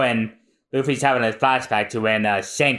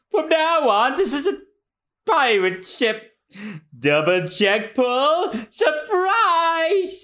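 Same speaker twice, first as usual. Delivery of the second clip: higher, puffed-up pirate boast; a higher, gloating brute yelling surprise; Pearl's voice. Acted. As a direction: swagger it out as the armored pirate Pearl. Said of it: "No, that's still Pearl saying that".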